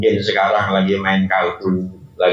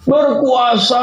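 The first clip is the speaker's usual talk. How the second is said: half shout; 13 semitones higher, slower than usual. Acted. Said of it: "setengah teriak ngomongnya". (setengah berteriak, nada tinggi)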